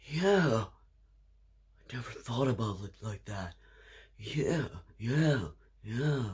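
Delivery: a guy quietly conceding the point; quiet sheepish agreement. Retold or imitated. Stranger agrees, quoted.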